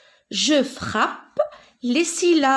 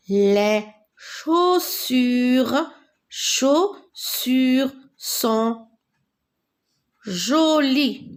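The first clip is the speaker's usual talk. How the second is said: slow syllabic reading; much slower than usual. Shown as a demonstration. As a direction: stretch each syllable of chaussures very slowly. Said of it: "lecture syllabée lente". (lecture très lente, syllabe par syllabe)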